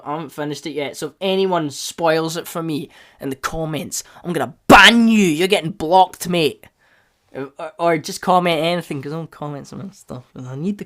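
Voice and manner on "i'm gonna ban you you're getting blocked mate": loud, raised-pitch warning; hammed-up threat to a spoiler; mock threat. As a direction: lay down the law, jokingly.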